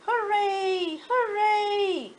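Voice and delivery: high, joyful cheer; cheering clown. Acted. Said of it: "cried the funny little clown".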